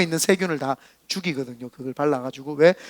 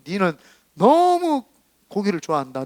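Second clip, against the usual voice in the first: drawn-out scolding on 너무; a slow, emphatic divine rebuke; slow stressed rebuke. Retold or imitated. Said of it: "말씀을 하시는 것 같았어요".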